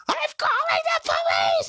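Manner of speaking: put-on high falsetto shout as his girlfriend; mock girlfriend shout. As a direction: shriek it like the girlfriend threatening to call the police. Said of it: "she was like".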